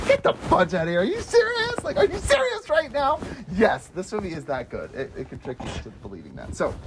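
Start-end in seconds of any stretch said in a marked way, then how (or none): 0.1-3.2 high incredulous are you serious; hammed-up outrage at the twist; mock disbelief. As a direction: squeal in disbelief. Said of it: "And you're like".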